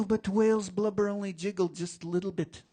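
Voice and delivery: high, strained storyteller voice settling down; oral storytelling. A narrator's reading. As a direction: narrate on the tail of a big heave. Storyteller after the push.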